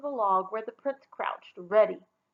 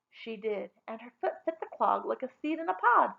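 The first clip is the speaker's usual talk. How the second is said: plain reading voice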